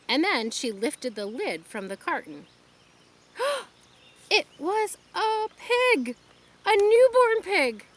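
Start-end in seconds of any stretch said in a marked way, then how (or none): 3.4-3.7 sharp high gasp of delight; a girl seeing the piglet in the box. Acted. Girl opens the carton. 4.3-7.8 high, loud, slowed delight; excited discovery. A narrator's reading. Discovering the piglet.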